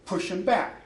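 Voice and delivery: ordinary talk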